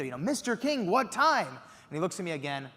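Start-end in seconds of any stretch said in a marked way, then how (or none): 0.2-1.5 shouting through window. Retold or imitated. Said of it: "shouting through the window".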